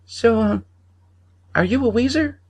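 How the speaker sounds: put-on very high hesitant boy voice; a little boy asks again, unsure; high curious child. A narrator's reading. Boy presses stranger again, high hesitant child voice.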